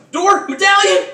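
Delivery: high, very loud single-word cries; urgent shout. Acted. Game master voices runners spotting the door, urgent shout. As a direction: yell each word as an alarm. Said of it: "you're like".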